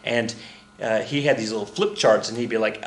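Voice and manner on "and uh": normal talking voice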